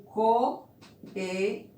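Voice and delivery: "coelho" broken into co, e, lho with pauses; syllable by syllable. Shown as a demonstration. Teacher filling in the syllable boxes, word split up slowly. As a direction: say "coelho" slowly in three separate pieces.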